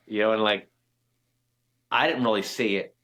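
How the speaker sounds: ordinary delivery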